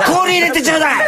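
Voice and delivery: put-on old man shrieking at the waitress for ice; shrill loud shout. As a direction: squeal for ice like a desperate old drunk. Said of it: "甲高い大声で".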